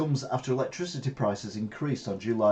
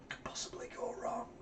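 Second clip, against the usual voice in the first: whisper; 14 dB quieter than usual. Real, not acted. Sarcastic aside after news.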